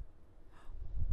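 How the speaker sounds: sudden startled intake of breath; sharp gasp. Real, not acted. Owner startled by a noise, sharp gasp.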